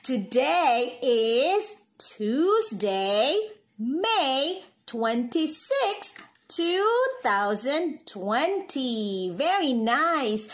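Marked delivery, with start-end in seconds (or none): 0.0-9.3 slow, drawn-out teacher dictation; slow and clear. Shown as a demonstration. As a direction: spell it out slowly. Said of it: "slow dictation for kids".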